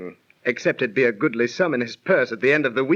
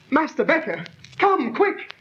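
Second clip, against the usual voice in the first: much higher, alarmed cry; panicked call for help; 9 semitones higher than usual. Acted. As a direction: shout for the manager in shock. Finds friend stabbed, calls for help.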